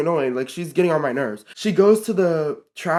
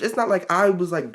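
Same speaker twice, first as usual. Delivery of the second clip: usual speaking voice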